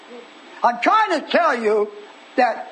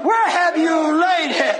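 raised, drawn-out proclamation; preaching shout; 5 semitones higher, slower than usual. Acted. Preacher voicing Jesus to Martha, preaching shout. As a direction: proclaim the question high and slowly.

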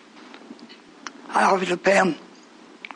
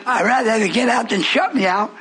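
ordinary talk

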